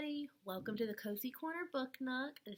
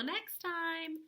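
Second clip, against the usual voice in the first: cheery bye; 6 semitones higher, 5 dB louder, much slower than usual. Real, not acted. Cheerful sign-off to kids.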